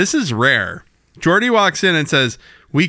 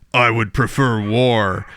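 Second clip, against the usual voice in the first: Worf impression; 4 semitones lower, slower than usual. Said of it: "Worf is just like".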